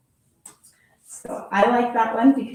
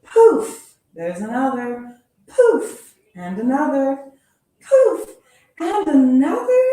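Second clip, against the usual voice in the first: higher and louder with each poof; escalating excited high exclamations; rising alarm; 4 semitones higher, 6 dB louder than usual. Acted. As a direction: build the panic with every poof. Unicorns keep appearing.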